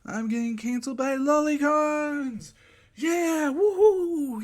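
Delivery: high, loud whooping hype; over-the-top excitement. Acted. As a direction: scream it out like a hyped streamer. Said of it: "I got way too excited".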